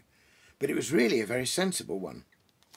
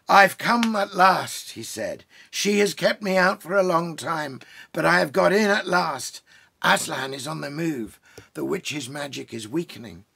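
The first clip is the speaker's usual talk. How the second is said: Father Christmas; 5 semitones higher, 8 dB louder than usual. A narrator's reading. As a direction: proclaim his arrival gladly.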